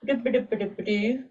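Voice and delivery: quick burbled nonsense syllables; idle scatting. Real, not acted. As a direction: burble "doo-ba-doo-ba" to fill the gap. Idle noise while finding place.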